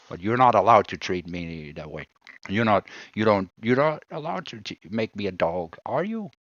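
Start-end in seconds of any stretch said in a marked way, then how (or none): none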